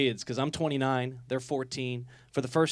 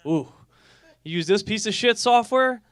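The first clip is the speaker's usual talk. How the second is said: higher, louder scoffing question; mock disgust; 7 semitones higher, 5 dB louder than usual. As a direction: sneer at the software. Mocking a bad software choice.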